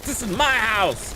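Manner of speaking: This is my house drawn out, high; slow defiant shout. Pretend. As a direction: slowly yell This is my house. Defiant game boast, repeated.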